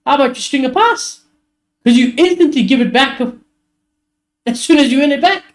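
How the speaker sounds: hammed-up manager telling off his team at halftime; ranting manager. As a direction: tell the squad off, building to a high-pitched scold. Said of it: "I'd be like".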